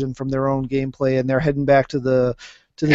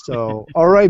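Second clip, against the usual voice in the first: normal talking voice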